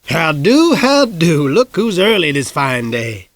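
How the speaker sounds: lightly voiced old man, cheerful hello; a hint of a folksy old storekeeper; gentle put-on old-timer welcome.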